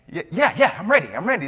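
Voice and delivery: nervous laughing. Retold or imitated. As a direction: brush it off with a jittery laugh. Nervous answer to rival.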